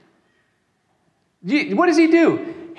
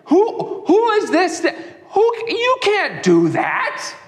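higher, sputtering indignant protest; a hint of hammed-up scandalized Pharisee; indignant muttering Pharisees; 8 semitones higher than usual. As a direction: sputter in outraged disbelief. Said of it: "are gonna mutter".

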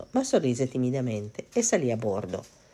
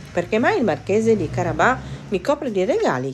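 puzzled king; 7 semitones higher, 4 dB louder than usual. Acted.

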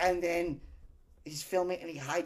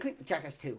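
ordinary delivery

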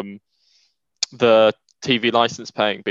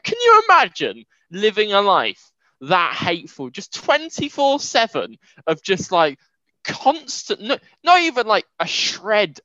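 exasperated rant; 7 semitones higher than usual. Real, not acted. Ranting about a hateful character.

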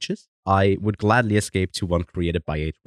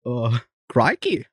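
bad Australian accent; slower than usual. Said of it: "I cannot do an Australian accent".

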